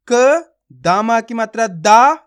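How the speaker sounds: slow teacher drawing out दा; stretched syllable. Shown as a demonstration. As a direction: stretch the दा out slowly for the learner. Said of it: "खींच के पढ़ेंगे दा".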